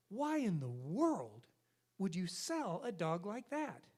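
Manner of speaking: drawn out, slow disbelief; slow incredulous question. Acted. Jim asking the clerk, slow and baffled. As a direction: stretch it out in amazement. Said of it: "He says".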